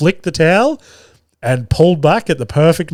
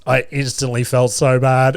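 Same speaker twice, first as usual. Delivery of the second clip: normal talking voice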